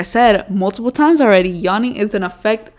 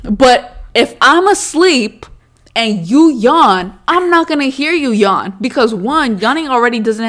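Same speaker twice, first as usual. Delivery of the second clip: loud, higher, slow insistence; heated, insisting to partner; 4 semitones higher, 6 dB louder than usual. Real, not acted. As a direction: hammer the point home.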